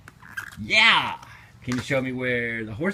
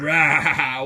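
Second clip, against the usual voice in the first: louder playful tiger rah; playful roar; 2 semitones higher, 5 dB louder than usual. Pretend. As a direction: growl like a friendly tiger. Imitating tiger roar for toddler.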